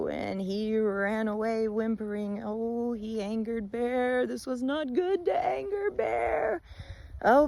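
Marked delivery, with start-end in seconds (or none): none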